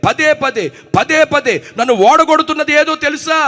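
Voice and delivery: Paul's anguished cry. Acted. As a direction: cry out Paul's struggle with the flesh. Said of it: "అపోస్తలుడైన పౌలు అంటున్నారు". (పౌలు వేదనతో బిగ్గరగా మొరపెట్టడం)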